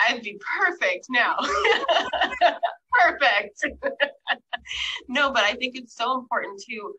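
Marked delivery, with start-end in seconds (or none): 0.1-4.1 joking through bursts of laughter; laughing. Real, not acted. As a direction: crack up on your own joke. Laughs at own hindsight joke.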